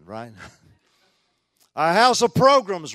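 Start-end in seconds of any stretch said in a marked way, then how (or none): 1.8-2.9 high, loud, tongue-in-cheek announcement; mock proclamation. Preacher teasing church about its priorities, mock-grand proclamation. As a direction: proclaim it grandly, as if it were scripture.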